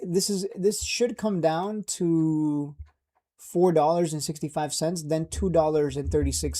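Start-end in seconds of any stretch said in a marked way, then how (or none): none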